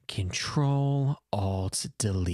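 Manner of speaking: slow, low computer command; drawn-out command. Retold or imitated. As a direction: draw each word out, pitched low. Solemn command in retold bit.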